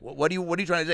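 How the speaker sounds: high, impatient questioning; confused cartoon dog. Dog questioning the stuttering pig, mock confusion. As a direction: press the stammerer for an answer. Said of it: "and the dog's like".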